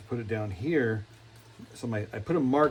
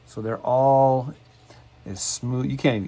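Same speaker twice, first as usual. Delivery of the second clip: ordinary delivery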